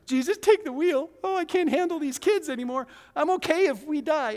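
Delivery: high, hammed-up despairing wail; desperate parent. Frantic parent in the car praying, hammed-up and high. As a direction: plead to the sky in mock despair.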